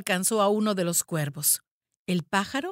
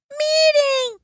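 very high, loud cry; little girl calling out in alarm; piercingly high child's yell; girl's shout; 8 dB louder than usual. A narrator's reading. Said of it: "gritó una de las niñas".

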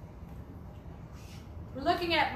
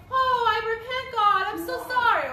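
mock desperate plea; 5 semitones higher, 5 dB louder than usual. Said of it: "people are gonna say".

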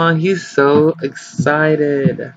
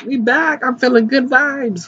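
much higher upbeat self-quote; imitating hype; 11 semitones higher than usual. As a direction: bounce it like a fresh start. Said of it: "when I was like".

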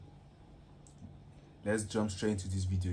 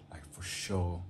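whispered aside; 3 semitones lower, 3 dB quieter, slower than usual. Real, not acted. Aside to viewers.

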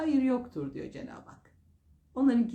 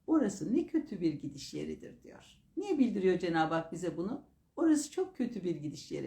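ordinary talk, nothing marked

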